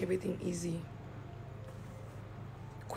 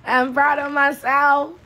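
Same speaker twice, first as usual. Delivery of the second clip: proud exclaim; 7 semitones higher, 11 dB louder than usual. Real, not acted. Bragging about her dancing.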